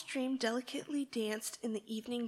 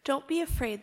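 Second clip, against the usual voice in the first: louder call into the dark; 2 semitones higher, 7 dB louder than usual. Acted. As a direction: call out to reassure the lamb. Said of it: "the shepherd called out".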